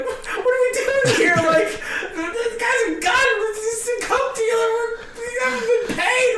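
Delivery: very high, squeaky mock panic; freaking out, retold. Comics in hotel conference room, hammed-up freaking out. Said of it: "I'm freaking out".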